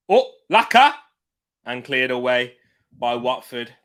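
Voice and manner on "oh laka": surprised shout. Real, not acted. Early chance in live game.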